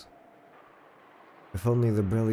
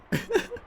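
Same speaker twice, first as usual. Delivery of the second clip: much higher squeaky laugh; high giggle. Acted. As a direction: giggle mischievously. After tie it to Rosa.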